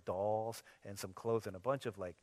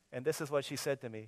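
ordinary talk, nothing marked

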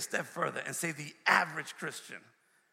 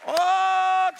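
long, high, loud held "Oh"; loud preacher's cry; 14 semitones higher, 13 dB louder than usual. Real, not acted. Preacher crying out to Jesus, loud exclamation. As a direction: cry out at the peak of the sermon.

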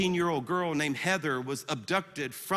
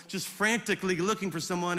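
usual speaking voice

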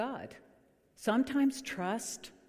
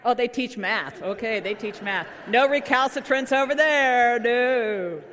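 louder, mock-accusing tease of the math teachers; playful teasing; 4 semitones higher, 10 dB louder than usual. Real, not acted. Pastor teasing math teachers in the pews, loud playful jab. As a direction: rib the teachers with a grin.

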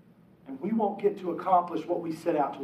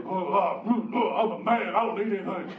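mock manly husband. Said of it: "they use that deep voice".

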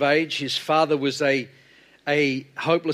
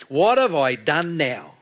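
louder, slow, drawn-out aggrieved complaint; younger brother's protest; 5 dB louder, slower than usual. Acted. David answering his scolding older brother, aggrieved. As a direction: protest like a put-upon little brother. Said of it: "that's the words of a younger brother".

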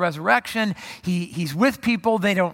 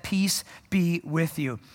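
peaceful greeting; 4 semitones lower, 6 dB quieter, slower than usual. Acted. Jesus greets frightened disciples.

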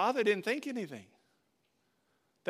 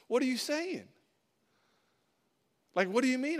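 higher-pitched, mock-offended questioning; taken-aback protest; 5 semitones higher than usual. Pastor answering people who doubted his church plant, taken aback. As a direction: react as if mildly insulted, voice rising. Himself retold, reacting to doubters.

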